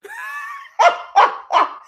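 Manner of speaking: high-pitched, loud cackle; helpless high laughing fit; real laughter. Real, not acted. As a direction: burst out in a shrieking laugh. Cracks up at own retelling.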